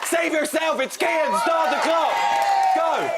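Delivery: high, loud game-show call; excited game call. Real, not acted. Host launching the timer for the panel with an excited call. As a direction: shout it out to start the clock.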